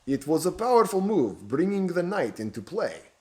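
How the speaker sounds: a sly Romanian lilt over the chessboard; Romanian accent. Acted. Stranger to the bishop over chess, in a Romanian accent. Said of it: "speaking in an accent rich with a Romanian air".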